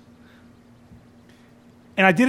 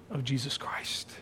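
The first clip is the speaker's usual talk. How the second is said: whispered question; 6 semitones lower, 14 dB quieter than usual. Acted. Pastor asking the congregation, whispering the close. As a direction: drop the last words to a breathy whisper.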